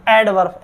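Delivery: "एडवर्ब" as ed-varv, R sounded; students' mispronunciation. Shown as a demonstration. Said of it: "ऐसे बोलते हैं विद्यार्थी". ("एडवर्ब" में र सुनाते हुए)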